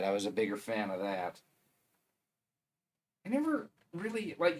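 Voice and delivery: normal talking voice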